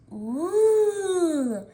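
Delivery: a pleased little cat coo; kitty delight. Acted. Kitty cooing happily at her bowl.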